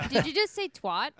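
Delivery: ordinary talk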